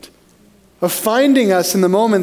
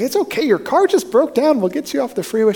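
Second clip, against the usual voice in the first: higher, lightly acted reassurance; mock comforting passer-by; reassuring helper; 7 semitones higher than usual. Stranger to the bawling driver, lightly acted reassurance.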